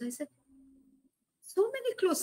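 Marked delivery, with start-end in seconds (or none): 1.4-2.2 "many" leaps much higher in disbelief; incredulous echo. Retold or imitated. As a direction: echo her words back, astonished. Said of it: "Maria, I have so many clothes".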